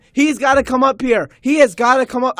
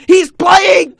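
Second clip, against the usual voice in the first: higher, louder cry of disbelief; incredulous shout; 6 semitones higher, 4 dB louder than usual. Real, not acted. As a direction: shout like you can't believe it. Shouting disbelief about the bench.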